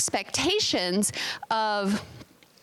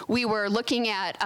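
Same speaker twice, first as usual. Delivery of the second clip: ordinary delivery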